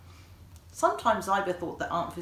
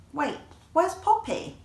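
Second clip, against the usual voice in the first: put-on high, slow worried child voice; sudden alarm; 10 semitones higher, 4 dB louder than usual. A narrator's reading. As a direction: stop short and look around for a friend. Child suddenly missing her friend.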